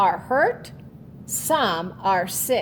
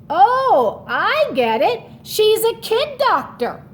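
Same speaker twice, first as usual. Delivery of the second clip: put-on excited kid; 10 semitones higher, 5 dB louder than usual. Child realizes answer.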